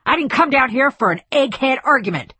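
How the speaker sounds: loud, high irritated retort; snapped. A narrator's reading. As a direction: snap back indignantly. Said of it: "snapped Speidel".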